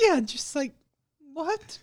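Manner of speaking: squeaky high "What?"; shrill disbelieving what; high mock surprise. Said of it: "mock surprise".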